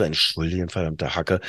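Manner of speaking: leise, tief gemurrter Ärger; genervter Gedanke. Retold or imitated. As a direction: den Fluch halblaut in sich hineinknurren. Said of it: "ich dachte so". (leise und tief grummelnd vor sich hin fluchen)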